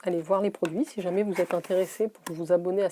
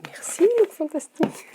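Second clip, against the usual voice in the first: high, chirpy thank-you to Look Fantastic; mock-gushing merci; bright playful thanks; 9 semitones higher, 4 dB louder than usual. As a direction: sing out the thanks like a cheerful shout-out. Playful cheery thanks to the brand.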